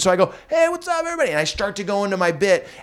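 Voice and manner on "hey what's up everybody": high-pitched hello to a crowd; stage greeting. Retold or imitated. Comedian greets the packed house as the bit starts, upbeat. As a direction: re-enact a big, upbeat stage opener.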